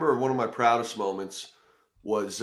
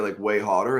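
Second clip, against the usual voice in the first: ordinary delivery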